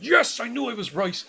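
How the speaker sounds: loud, high gleeful cheer; crowing with delight; triumphant. Retold or imitated. Retold triumph at being right.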